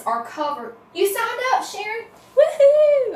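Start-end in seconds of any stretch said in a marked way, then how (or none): 0.9-2.1 delighted surprise. Real, not acted. Viewer signed up for event. 2.4-3.1 high, loud woohoo; excited cheer. Real, not acted. Host cheering a viewer who signed up, high and loud.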